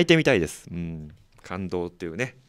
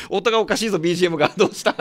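high-pitched surprise at a glitch; react, pitch jumping up; startled reaction; 8 semitones higher, much faster than usual. Real, not acted. Host reacts to background music glitch. (驚いて高い声で)

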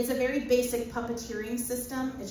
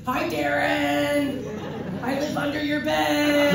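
slow, louder creepy monster tease; creepy playful under bed whisper; 5 semitones higher, 7 dB louder, much slower than usual. Acted. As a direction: menace him playfully like a bedtime monster. Creature voice teasing Darren.

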